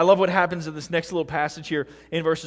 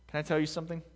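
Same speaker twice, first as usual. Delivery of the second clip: ordinary talk, nothing marked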